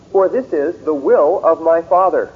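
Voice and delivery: slow scripture reading with "will" hit hard; stress on will. Shown as a demonstration. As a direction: press hard on "will" in "the will of my Father". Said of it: "I'm emphasizing those specifically".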